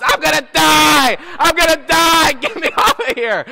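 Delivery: high, loud panicked wail; reenacted terrified screaming; screaming at top of lungs. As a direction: holler in hammed-up mortal terror. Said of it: "I was screaming at the top of my lungs".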